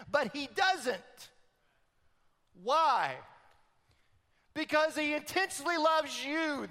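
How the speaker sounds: loud, high preaching climb; preaching at full force. Acted. Preacher proclaiming Jesus's authority to the church, loud and high. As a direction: build the sermon to a soaring peak.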